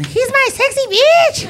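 high squealing mock wife; mock wife squealing her pet name; wife's voice. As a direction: squeal it like his adoring wife. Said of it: "His wife calls him Sexy".

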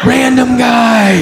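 high, drawn-out cry of alarm; hammed-up dawning panic, stretched out; panicked. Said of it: "Now I'm panicked".

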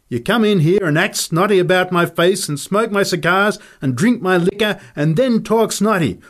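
loud, high, mounting tirade; furious rant. Acted. Fighter rants at the freeloading Nick, furious and rising.

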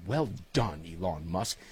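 trailing mock English accent. Host repeating his praise of Elon in an English accent. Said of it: "to be spoken in my best English accent".

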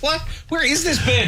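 high-pitched baffled dog voice; high mock-indignant outburst. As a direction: voice a dog's exasperated, comic disbelief. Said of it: "The dog's like".

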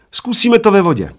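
high, loud triumphant proposal. A narrator's reading. Little man proposes a rematch to bald friend, triumphantly. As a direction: announce it as a winning idea. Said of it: "prohlásil najednou maličký vítězoslavně".

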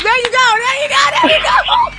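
rising excitement. Real, not acted. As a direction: cheer the winner on, building to a laughing shout. Host cheers the winning caller.

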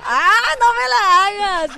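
exclama mucho más fuerte y más agudo, indignado; grítalo como quien no lo puede creer; grito indignado. Retold or imitated. (grito de indignación exagerado al hablar de las rosetas)

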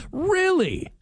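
high-pitched surprised question straight after a laugh; amused disbelief. Real, not acted. Laughs at surprising listener text.

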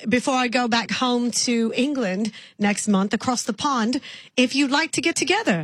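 lightly put-on English accent calling a stranger "love"; sweet English caller, accent worn lightly; British accent. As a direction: play a chatty Englishwoman with a mild accent. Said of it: "I'm from England".

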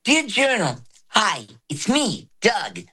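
high, gentle tweener cartoon voice; Doug. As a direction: voice an eleven-year-old cartoon boy writing in his journal. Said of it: "but his voice was like".

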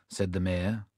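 plain reading voice

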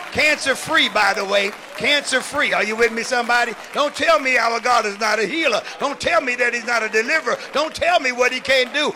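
loud, driving sermon delivery; full-force preaching. Real, not acted. Healed bishop testifies to congregation, loud exhorting preaching. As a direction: punch each phrase out to the crowd.

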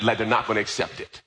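ordinary talk, nothing marked

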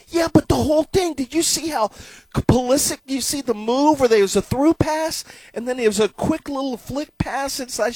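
high, eager explaining; husband insisting the buildup was brilliant; excited defence. Retold or imitated. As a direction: sell the whole move.

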